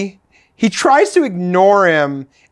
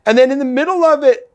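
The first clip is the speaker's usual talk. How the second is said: ordinary talk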